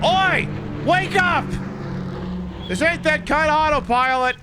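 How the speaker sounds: very high, loud shout of Oi; mock yell. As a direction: yell to wake a dozing pilot. Yelling at a plane in game.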